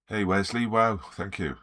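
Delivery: ordinary talk, nothing marked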